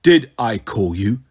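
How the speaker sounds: low, slow, measured demand; imperious undead king questioning his guards. A narrator's reading. Tarquin scolding his two skeleton guards, demanding. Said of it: "demanded the king".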